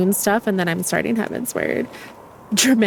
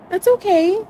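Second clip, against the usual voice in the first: much higher, sing-song coaxing voice; coaxing to bed; 11 semitones higher, faster than usual. Real, not acted. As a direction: gently talk a little one into bed. Soothing a pet to sleep.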